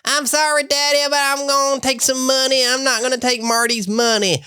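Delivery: very high, whiny put-on Missouri voice; Missouri accent. Imitating Ozark characters for a friend, in a very high Missouri accent. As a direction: play the Ozark daughter pleading in a shrill twang. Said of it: "Missouri accent or whatever it is".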